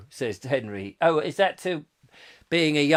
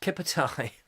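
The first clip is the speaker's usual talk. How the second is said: softer Brummie echo of the phrase; Brummie accent; 3 semitones lower, 7 dB quieter than usual. Retold or imitated. Explaining the local cup of tea, Brummie accent. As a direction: say "Kipper tie" the Brummie way. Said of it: "Great Brummy accent".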